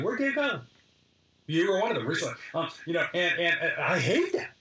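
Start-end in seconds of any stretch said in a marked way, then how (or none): 0.0-0.6 mock puzzled. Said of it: "There are people going".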